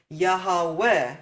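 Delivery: Yahweh as ya-ha-weh, extra ha; three parts. Shown as a demonstration. Teacher matching music's rhythm, Yahweh in three parts. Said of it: "where you used to have three parts".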